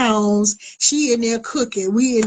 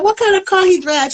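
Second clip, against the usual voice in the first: high, louder, mock innocent question; fake innocent; 9 semitones higher, 4 dB louder than usual. Woman feigning ignorance to her rival, high and innocent. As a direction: act like you don't know a thing. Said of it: "Trying to act like I ain't know".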